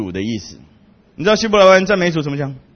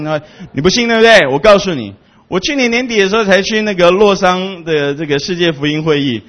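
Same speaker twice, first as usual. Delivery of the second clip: normal talking voice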